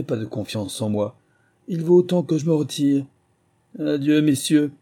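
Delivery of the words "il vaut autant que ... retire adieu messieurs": raised, offended voice, higher and louder; wounded pride turning to anger. A narrator's reading. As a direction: storm off in a huff. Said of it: "d'un air courroucé".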